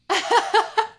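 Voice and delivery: loud, high burst of laughter. Acted. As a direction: break up laughing at the prank. After the nunya joke.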